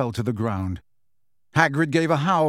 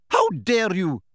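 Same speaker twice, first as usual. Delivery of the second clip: shouted; 5 semitones higher, slower than usual. A narrator's reading. McGonagall running to Hagrid, indignant shout. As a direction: call it out while running. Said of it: "the figure shouted".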